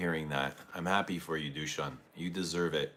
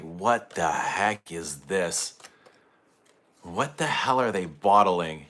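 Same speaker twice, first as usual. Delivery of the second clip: retold angry outburst; slower than usual. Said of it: "I was so angry".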